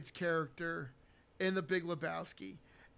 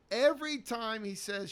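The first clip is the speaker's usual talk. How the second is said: ordinary talk, nothing marked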